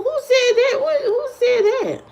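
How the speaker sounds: high-pitched, incredulous demand; indignant disbelief. Real, not acted. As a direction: squeal it as if shocked by the remark. Mock outrage at a comment.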